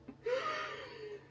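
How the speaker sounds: very high, soft laugh; quiet high-pitched giggle. Real, not acted. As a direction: let out a small squeaky giggle. Laughs after admitting buying instant purée.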